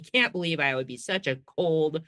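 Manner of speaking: ordinary delivery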